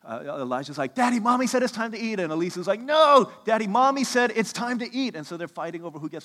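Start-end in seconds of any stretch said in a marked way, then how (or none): 0.9-2.2 higher, louder imitation of an excited kid; mock-yell it like a kid racing to report; retold yelling child. Son bursts in on Daddy with news, mock yelling. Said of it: "They're screaming. There's yelling". 2.8-5.2 high, loud mock shout of a competing kid; retold screaming child. Daughter fights brother to tell Daddy first, mock screaming. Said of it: "They're screaming. There's yelling".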